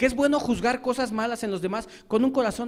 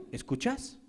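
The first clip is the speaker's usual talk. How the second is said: quiet echo; 2 semitones higher, 9 dB quieter than usual. Acted. Preacher echoing the reproach back at the accuser, softly. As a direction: turn it back on them in a murmur.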